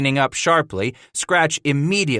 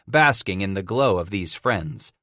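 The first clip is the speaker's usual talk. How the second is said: ordinary delivery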